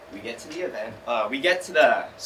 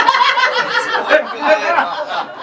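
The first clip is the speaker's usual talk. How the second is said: loud high cackling laugh; high laughing fit; 13 semitones higher, 8 dB louder than usual. Real, not acted. Storyteller laughing at getting second again. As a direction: laugh helplessly.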